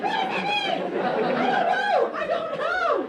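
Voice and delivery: very high, loud, laughing panic; panicked high squeal. Retold or imitated. Confronting 401K talk, high laughing panicked squeal. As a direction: squeal in mock helplessness. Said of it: "And I'm like".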